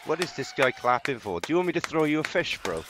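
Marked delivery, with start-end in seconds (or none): none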